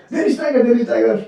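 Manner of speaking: high-pitched mock alarm; false alarm cry. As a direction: cry out "tiger" as a prank. Friends shout a false alarm.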